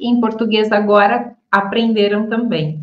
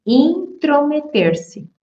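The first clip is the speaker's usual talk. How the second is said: slowly and higher, word by word; slow clear model word; 7 semitones higher, slower than usual. Shown as a demonstration. Teacher slowly models the new word for students. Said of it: "Então, quer dizer".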